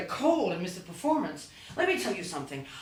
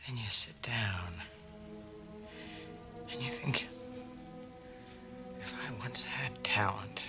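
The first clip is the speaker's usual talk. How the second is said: hushed confiding; 15 dB quieter than usual. Acted. Confiding monologue on being washed up.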